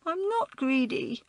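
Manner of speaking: put-on high, wailing little-boy voice. A narrator's reading. Small boy denies being greedy to older girl, wailing. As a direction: wail it in childish protest. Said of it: "he wailed".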